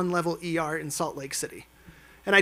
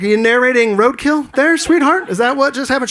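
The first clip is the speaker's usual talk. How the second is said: mock-teasing call-out; 6 semitones higher, 9 dB louder than usual. Teasing his wife about roadkill.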